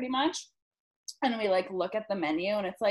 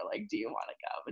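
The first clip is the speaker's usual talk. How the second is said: hushed invitation; 9 dB quieter, faster than usual. Retold or imitated. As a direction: drop to a whisper on the question. Whispered invite to strip club.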